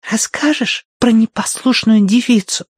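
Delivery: put-on a child's loud stage whisper. A narrator's reading. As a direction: whisper theatrically, like a little girl. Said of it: "театральным шёпотом спросила она".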